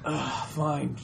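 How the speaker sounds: grudging, soft sigh of fine; annoyed ugh. Retold or imitated. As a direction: give in with a quiet exasperated ugh. Reluctant reply in acted scene.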